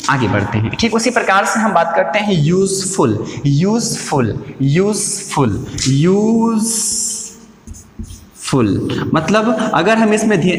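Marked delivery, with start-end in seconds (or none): 2.3-5.6 useful said slowly as two parts, यूज and फुल; syllable by syllable. Shown as a demonstration. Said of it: "हमारे सामने यूज एक syllable". 5.9-9.1 syllable by syllable. Shown as a demonstration. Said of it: "फुल दूसरा syllable".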